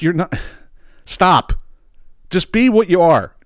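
high, slow "just be what you are"; pleading with suburban fans, exasperated; exasperated plea. Real, not acted.

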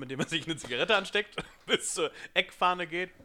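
laughing through the story; giggling out the words. Real, not acted. Laughing while telling.

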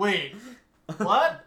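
loud double take; mock disbelief. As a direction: caught off guard that the film keeps going. Realizing a half hour is left.

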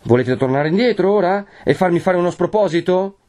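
high, rising challenge; exasperated warning. A narrator's reading. Renzo warns against backing out, exasperated. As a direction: warn her with mounting impatience.

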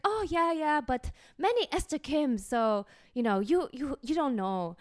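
put-on high, loud accented auntie brush-off; lightly mocking old Korean church lady; older Korean lady. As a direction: wave it away like a dismissive church auntie.

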